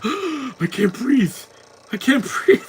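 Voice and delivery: high, slow strained mock gasp of I can't breathe; hammed-up choking villain; mocking a wheezing villain; mock Bane voice. Mocking Bane's breathing line.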